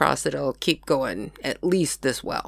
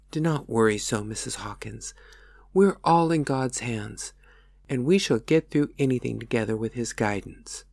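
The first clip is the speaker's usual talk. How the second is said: gentle pastor; 6 semitones lower than usual. Acted. Pastor comforts housekeeper.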